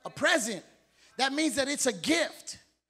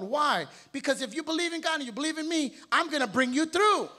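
normal talking voice

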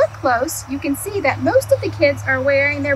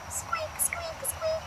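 very high, much softer squeaks; mouse squeaks; 15 dB quieter, slower than usual. Acted. Little Mouse squeaking at Duck, high and soft. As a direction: squeak tiny and faint like a mouse.

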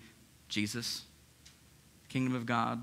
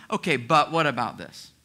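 louder, resistant yeah-but; defensive pushback; 2 semitones higher, 7 dB louder than usual. Retold or imitated. Pastor voicing the offended listener's loud objection. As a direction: push back as if offended. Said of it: "your initial response going".

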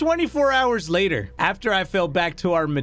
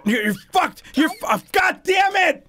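frustrated shout; 10 semitones higher than usual. Real, not acted. Failing the jump.

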